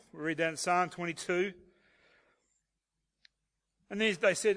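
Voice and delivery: normal talking voice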